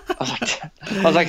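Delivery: ordinary delivery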